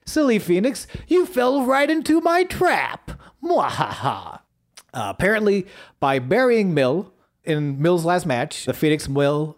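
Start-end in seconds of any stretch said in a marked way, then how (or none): 0.1-4.4 sneering panto villain. Retold or imitated. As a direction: gloat like a cartoon villain. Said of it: "pulls a straight up Tanya".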